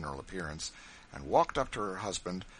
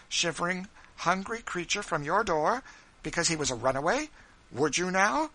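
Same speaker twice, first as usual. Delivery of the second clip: higher, louder, pressing challenge; challenging; 9 semitones higher, 6 dB louder than usual. Acted. Wife challenging husband John, higher and insistent. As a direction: pin him down with the question.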